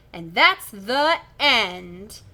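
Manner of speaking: very slow, drawn-out close; slow dramatic ending. Acted. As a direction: draw out the final line.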